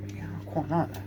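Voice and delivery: breathy hushed aside; soft whispered admiration. Real, not acted. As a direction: whisper it like a secret. Quiet aside admiring his work.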